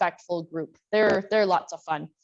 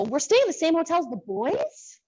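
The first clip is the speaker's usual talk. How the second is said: higher-pitched mock excited question; imitating excited girls; 7 semitones higher than usual. Coach imitates her players at the hotel, mock excited. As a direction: play teen girls thrilled by the news. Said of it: "their eyes light up".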